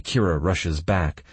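usual speaking voice

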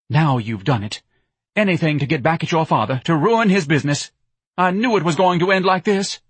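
loud, high-pitched scolding; wife's angry accusation. A narrator's reading. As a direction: rail at the husband, rising as it goes. Wife rounds on her husband.

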